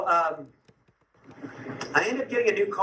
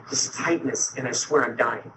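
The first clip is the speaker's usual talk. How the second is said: ordinary delivery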